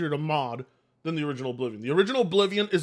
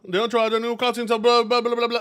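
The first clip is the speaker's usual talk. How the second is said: re-reading the line in a higher, louder put-on Jamaican voice; imitating a friend's Jamaican accent, higher and louder; mock Jamaican accent; 5 semitones higher, 5 dB louder than usual. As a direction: ham up a Jamaican lilt, then give up on it. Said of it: "you're not actually from Jamaica".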